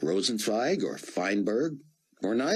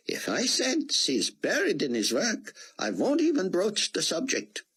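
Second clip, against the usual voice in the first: put-on high, foreign-sounding older gentleman; old Israeli scholar; 12 semitones higher than usual. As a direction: play the kindly old statesman, higher. Rosenzweig voiced by the narrator.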